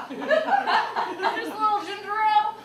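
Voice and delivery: slow, laughing line. Real, not acted. Comic laughing about her ginger ale. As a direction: laugh it out slowly.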